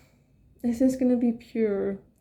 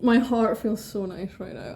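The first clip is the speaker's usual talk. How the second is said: normal talking voice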